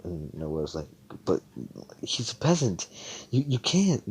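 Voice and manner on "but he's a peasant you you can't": high, faltering protest; acting out a desperate plea, pitch climbing. Noelle objecting to Mimosa liking a peasant, mock desperate. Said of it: "she's starting to sound desperate".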